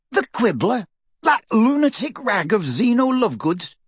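high, incredulous splutter. A narrator's reading. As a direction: splutter it out, choking. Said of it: "he spluttered".

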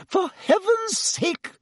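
very high, drawn-out exasperation; hysterical. A narrator's reading. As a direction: burst out in shrieky hysterics without shouting. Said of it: "said Madame Pomfrey hysterically".

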